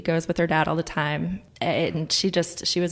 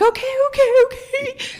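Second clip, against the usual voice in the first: excited; 8 dB louder than usual. Retold or imitated. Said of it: "I'm like".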